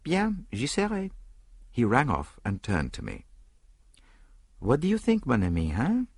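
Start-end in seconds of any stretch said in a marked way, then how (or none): 0.0-1.1 a short, drawn-out French acknowledgment, pitched up; Poirot, brief French reply. A narrator's reading. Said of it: "then with a brief". 4.6-6.1 put-on high, lilting French-accented question; French detective breaking news; Poirot, French-accented detective. A narrator's reading.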